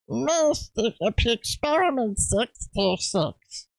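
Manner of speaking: raspy voice from the back of the throat, slowish; a small alien croaking from the throat; Stitch. Retold or imitated. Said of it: "really easy to do a Stitch impression".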